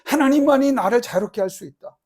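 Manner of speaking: building to a loud, high cry for blessing; desperate prayer. Acted. Jabez praying to God, rising to a loud cry. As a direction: plead harder with every line. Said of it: "그랬어요".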